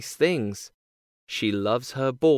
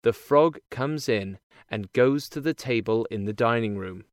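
plain reading voice

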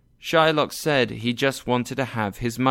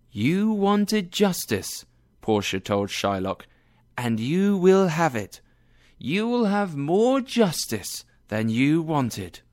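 slow, much higher judge's voice, solemn; Portia as judge; 6 semitones higher than usual. A narrator's reading. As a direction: pronounce the verdict slowly, pitched high.

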